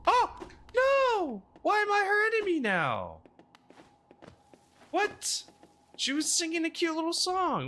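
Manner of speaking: shrill surprise yelp, way up high; high-pitched squeal. Real, not acted. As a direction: squeal as if betrayed. Attacked by cute creature.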